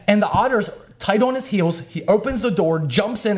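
ordinary talk, nothing marked

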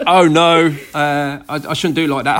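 a student caught out, playing it up as mock alarm; mock dismay. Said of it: "I'd be like".

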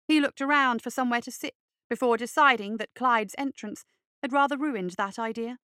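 ordinary delivery

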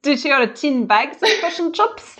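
put-on high, laughing send-up of a New Zealand accent; mock Kiwi accent. Host teasing Kiwi guest about fish and chips, mock Kiwi accent. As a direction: tease with squashed Kiwi vowels. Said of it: "take the piss out of Kiwis".

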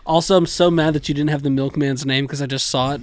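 normal talking voice

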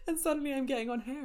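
laughs through the words, fast; a real giggle breaking in; laughing. Real, not acted. Telling listeners about her croaky voice, laughing.

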